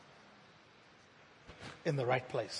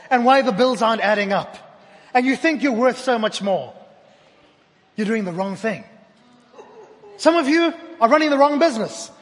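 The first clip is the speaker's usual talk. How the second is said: raised, loud preaching voice; 5 semitones higher, 5 dB louder than usual. Acted. Pastor preaching to the congregation, loud and fervent. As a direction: preach it out to the room, building.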